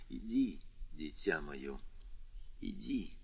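very soft, low fatherly voice; soft, tender. A narrator's reading. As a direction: gently send the child away. Father softens, kisses frightened child.

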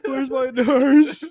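high, loud, slow mock wail; hammed-up confused patient crying out.

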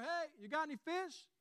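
high-pitched acted call-out; yell from shore. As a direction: call across the water to a boat. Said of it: "hears someone yell from the shore".

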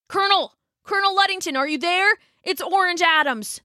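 put-on high, urgent young rider calling out. Wounded boy calls for the colonel, high and urgent. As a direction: call through the door, desperate.

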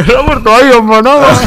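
mock sobbing reply. Answering his mother in a mock teary whine. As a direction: play his own crying self answering mum. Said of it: "Y yo".

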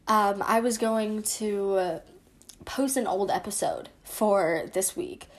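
ordinary talk

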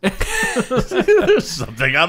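high, slow laughing repeat; teasing echo. Pretend. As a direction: repeat it back, giggling. Echoing friend's line amid laughter.

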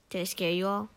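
ordinary talk

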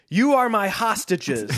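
slow sneering villain line. Acted. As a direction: sneer it at the captives. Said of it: "the smuggler sneered".

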